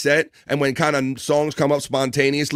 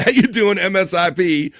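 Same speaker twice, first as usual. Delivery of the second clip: laughing; 7 semitones higher, 4 dB louder than usual. Real, not acted. Still laughing after Epcot joke.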